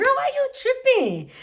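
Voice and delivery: high, sugary mock friendliness; sweet on top, needling underneath; petty fake sweetness. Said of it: "I'm so petty".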